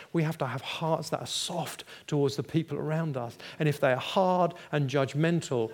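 normal talking voice

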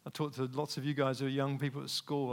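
ordinary talk

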